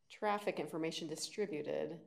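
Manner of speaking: ordinary talk, nothing marked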